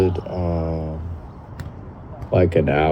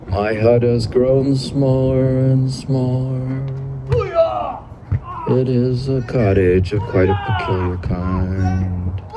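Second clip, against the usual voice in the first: higher literary recitation; reading old text aloud; 6 semitones higher than usual. Acted.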